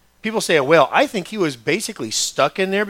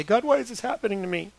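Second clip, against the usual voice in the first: plaintive complaint; 6 semitones higher, 4 dB quieter than usual. Acted. Acting out believer's complaint to God.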